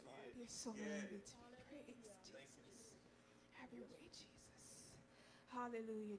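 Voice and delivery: soft, low murmured praise; hushed worship. Real, not acted. Minister quietly worshipping before speaking, hushed and soft. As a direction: let the prayer fade into quiet devotion.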